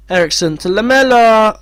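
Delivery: anxious play-by-play; tense commentary. Real, not acted. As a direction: call the attack with rising alarm.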